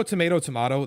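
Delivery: first tomato said as to-MAY-to, second tomato said as to-MAH-to; two pronunciations. Shown as a demonstration. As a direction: contrast tomato with a long A, then tomato with an ah. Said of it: "that saying".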